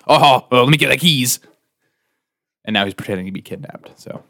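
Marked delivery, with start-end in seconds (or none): 0.1-1.4 loud high frantic voice; loud mock panic. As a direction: blurt it in a fake panic. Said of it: "And Jack goes".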